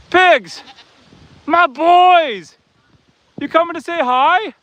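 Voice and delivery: high, loud, delighted calling to the pigs; excited greeting. Real, not acted. Farmer calling excitedly to his pigs over the fence. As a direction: greet them like long-lost friends.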